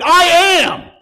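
high, loud proclamation as Jesus; booming declaration. Acted. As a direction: boom it out with full authority. Jesus's answer repeated, booming second take.

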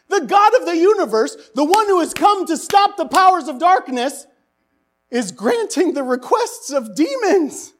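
high, loud mock-outraged sermon shout; full-voiced pulpit indignation, slowed on the key lines; preaching at full force. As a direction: thunder at the congregation in disbelief.

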